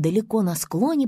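ordinary delivery

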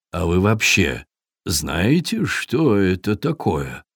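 low, slow, measured question; calm Viking. A narrator's reading. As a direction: ask slowly, like a patient elder. Viking calmly questions quarrelling animals.

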